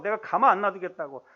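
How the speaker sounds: normal talking voice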